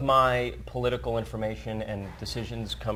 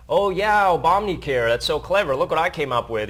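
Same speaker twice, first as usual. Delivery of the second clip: louder, higher, gloating imitation; mock self-congratulation; 4 semitones higher, 6 dB louder than usual. Retelling the candidate's debate chance, mock bragging. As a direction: crow it as a smug candidate's imagined boast. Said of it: "to say".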